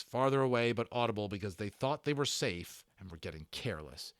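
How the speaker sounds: usual speaking voice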